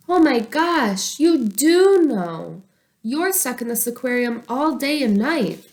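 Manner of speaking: light up with a sudden idea; excited realization. Acted. Boy realizing his fish are stuck, excited.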